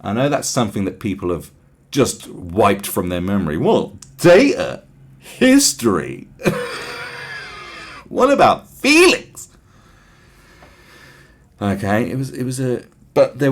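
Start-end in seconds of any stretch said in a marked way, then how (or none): 3.6-6.3 mock bafflement. Mocking people who ignore facts. 6.3-8.0 mock breathy giggle. Mocking the fact-deniers. 8.1-9.5 higher, slower, whiny mocking voice; slow sing-song sneer, pitched up. Mocking people who ignore facts.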